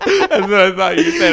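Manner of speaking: loud, high laughter through the words; laughing. Real, not acted. Friend teasing over a slip of "accent", laughing hard. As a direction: crack up while repeating the misheard phrase.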